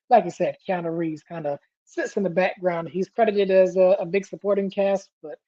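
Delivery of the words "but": ordinary talk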